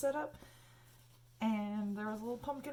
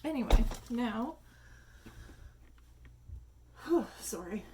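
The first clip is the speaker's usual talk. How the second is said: out of breath; 4 dB louder than usual. Real, not acted. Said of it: "getting out of breath again".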